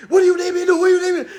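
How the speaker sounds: mock frantic girlfriend; exasperated call. Kid's mom calling to him, exasperated and loud.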